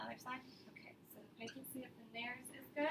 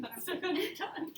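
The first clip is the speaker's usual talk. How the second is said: laughing; 7 semitones higher, 8 dB louder than usual. Real, not acted. Laughs at something on tongue.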